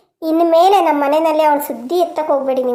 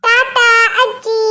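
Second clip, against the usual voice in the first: put-on slow, higher child's goodbye; little boy voice; 6 semitones higher, much slower than usual. Grandson waving bye to grandma, childlike. Adult voicing small grandson.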